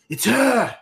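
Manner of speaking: high shrieking yell, spit flying; frenzied shout. Acted. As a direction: scream it out, wild and unhinged. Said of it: "spittle is just flying from his mouth".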